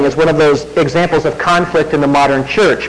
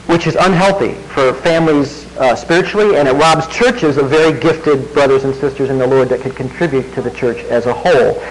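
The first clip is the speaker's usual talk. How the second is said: ordinary talk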